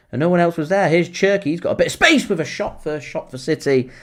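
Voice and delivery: excited football commentary, pitch climbing with the chance; live play-by-play. Real, not acted. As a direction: call the attack as it unfolds. Calls the attack live.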